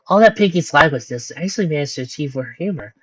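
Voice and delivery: usual speaking voice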